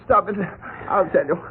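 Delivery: high, tearful begging, rushing to give in; terrified sobbing. Acted. Krug sobbing as he gives up the letter to Butch. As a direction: sob and plead until you crack. Krug breaks under beating.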